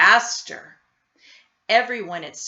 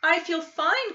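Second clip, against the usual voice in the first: put-on child's voice; 8 semitones higher, 4 dB louder than usual. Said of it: "Camila answered".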